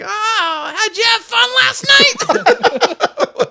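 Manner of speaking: put-on high, loud, slow old-lady tease; drawn-out teasing like a nosy old neighbour; mimicking Arlene. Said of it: "she's like".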